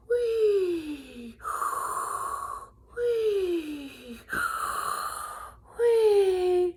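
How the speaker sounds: high, loud wheezy snores; whistling snore. A narrator's reading. Narrator imitating Big Mouse snoring loudly.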